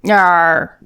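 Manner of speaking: ordinary delivery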